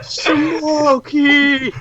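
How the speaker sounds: very high, drawn-out holler; mock yell. Player calling after the fleeing characters, long high yell. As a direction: belt out the name as a running joke. Repeats the joke escape yell.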